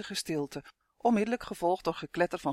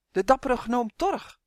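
high, louder triumphant hailing; jubilant; 6 semitones higher, 5 dB louder than usual. A narrator's reading. Twin greets the brave gnome, jubilant. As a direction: hail the hero with delight. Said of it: "jubelde Tummy".

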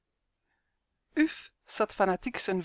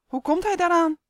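much higher and louder, shocked; shocked cry; 11 semitones higher, 5 dB louder than usual. Acted. Chance hears Janus has a ghost, shocked cry. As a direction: cry it out in alarm. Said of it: "riep Chance geschokt".

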